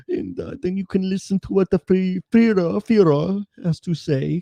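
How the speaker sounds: high, put-on mocking true-believer voice; lightly voiced conspiracy fanatic. Host mocking conspiracy listeners in a high put-on voice. Mocking Hitler fans in character.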